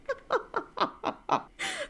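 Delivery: high, breathy giggling; long giggle fit. Real, not acted. Reader giggling helplessly at the drama, high giggles. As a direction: dissolve into giggles. Laughs hard.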